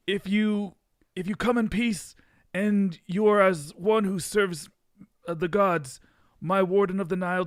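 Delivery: a grand, hammed-up pharaoh greeting; terrible pharaoh accent. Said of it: "now I have to do my terrible accent".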